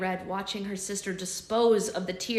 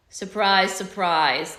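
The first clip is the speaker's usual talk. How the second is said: sarcastic; 7 dB louder, much slower than usual. Acted. Sarcastic remark.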